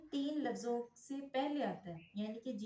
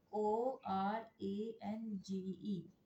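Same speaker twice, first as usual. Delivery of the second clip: letters spelled out very slowly one by one; slow spelling; much slower than usual. Shown as a demonstration. Teacher spelling orange letter by letter, very slowly. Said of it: "spelling orange".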